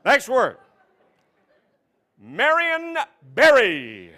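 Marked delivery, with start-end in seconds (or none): 2.2-4.1 slow, louder name reading; slow announcer. Pretend. Host announcing the word, slow. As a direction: draw the name out.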